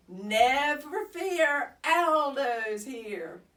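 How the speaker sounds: loud, slow heroic booming; roared. Acted. Aldo bursting in to rescue the hamster, roaring. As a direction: boom it like a superhero arriving. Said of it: "a familiar voice roared".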